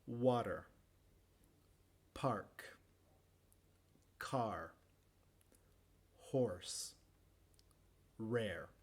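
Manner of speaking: careful word list, R heard in "Horse" and "Rare"; American R. Shown as a demonstration. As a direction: model words like "Water" and "Car" with the R sounded. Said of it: "en el inglés americano".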